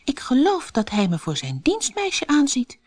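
thinking to herself. A narrator's reading. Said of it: "dacht ze".

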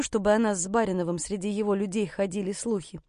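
usual speaking voice

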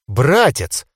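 mildly indignant reproach. A narrator's reading. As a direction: chide a brother in one word. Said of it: "тут же возмутилась Адда". (с лёгким возмущением)

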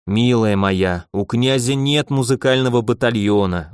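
ordinary delivery

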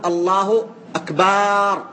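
"الله أكبر" slow, with "أكبر" drawn out so it sounds like "أكبار"; stretched akbar. Shown as a demonstration. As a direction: lengthen the second syllable of "أكبر" as a mistake. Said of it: "ينصرف المعنى ... إلى الكبر". ("الله أكبر" بمدّ الباء في "أكبر" ببطء)

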